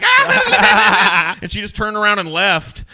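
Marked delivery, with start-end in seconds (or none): 0.0-1.4 high loud jeering laugh; mocking crowd jeer. Her crew jeering at him, mock laugh. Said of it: "her whole sycophant crew was like".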